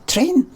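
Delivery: "train" high and short with a pure e; proper pronunciation. Shown as a demonstration. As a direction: insist on a clean "trehn" for "train". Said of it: "I'm saying it properly".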